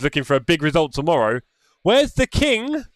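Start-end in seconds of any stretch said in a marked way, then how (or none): 1.8-2.8 mock calling out. Calling out for the missing driver.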